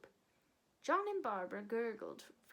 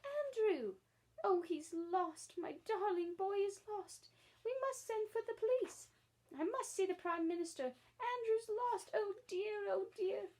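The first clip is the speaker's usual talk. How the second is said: high, loud, panicked wailing; frantic calling; 10 semitones higher, 4 dB louder than usual. Acted. Lady searching her garden for her lost dog, frantic high cries. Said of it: "waving her arms and calling".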